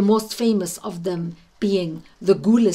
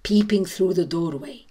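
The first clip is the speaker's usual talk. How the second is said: ordinary delivery, nothing marked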